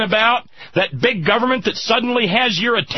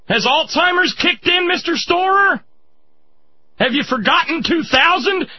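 angry rant; 5 semitones higher than usual. Real, not acted. Host berating the veteran in the ad, angry and mocking. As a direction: hammer him with each question.

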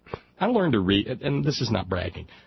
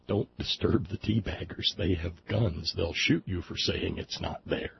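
a softer, conspiratorial hush; mock hushed; 3 semitones lower, 7 dB quieter than usual. Warning listeners not to wake the teabaggers, mock hushed. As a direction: keep it down like sharing a secret.

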